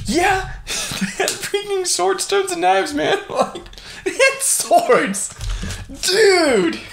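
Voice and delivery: very high, incredulous squeal; real astonishment breaking into laughter; laughing disbelief. Real, not acted. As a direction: crack up while exclaiming in amazement.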